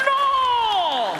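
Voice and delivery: very high, long-held "no"; radio host voice. Acted. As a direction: answer in a piercing on-air cry. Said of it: "why are you talking like that".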